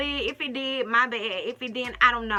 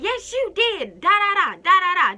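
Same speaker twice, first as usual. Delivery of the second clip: higher and louder, mocking her ranting; mock yelling; 7 semitones higher, 7 dB louder than usual. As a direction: ham up her yelling as babble. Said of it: "Just yelling".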